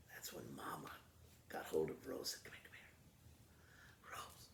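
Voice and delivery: low, hushed plotting; greedy mother plotting in a hush; scheming whisper. Acted. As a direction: whisper the plan so Blanche can't hear. Mama scheming with Rose.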